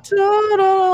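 high, loud showbiz "Ta-da!"; mock fanfare. Imitating a movie's cheery closing sting, loud and high. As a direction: sing out a cheesy reveal.

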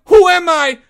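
very high, loud distraught cry; anguished scream. Acted. Porge horrified after confessing, anguished scream. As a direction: wail it out at full voice.

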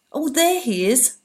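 a wife spotting her husband's body, shrieking; shriek of recognition. A narrator's reading. Desperate wife sees a figure lying, shrieks out. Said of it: "I shrieked".

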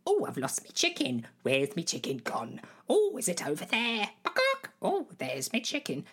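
high, exclaiming player hunting a chicken; hammed-up stage demonstration. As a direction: overact the search with swooping high cries. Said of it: "Allow me to demonstrate".

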